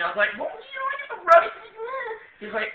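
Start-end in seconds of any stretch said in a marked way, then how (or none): 0.4-1.5 very high imitating squeal; squeaky explanation. Telling the first sergeant what he means, very high squeak. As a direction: squeak it out far above your voice.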